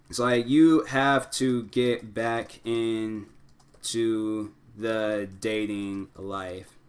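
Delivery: very slow, spaced-out words; composing aloud. Real, not acted. Advisor typing a comment on a divorce post, slow composing aloud. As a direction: draw out each phrase with pauses while typing.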